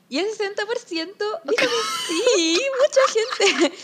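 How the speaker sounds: high, loud, slow words broken by laughter; incredulous giggling over the percentage; laughing in disbelief. Real, not acted. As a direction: laugh through the number, amazed. Laughing at the survey result.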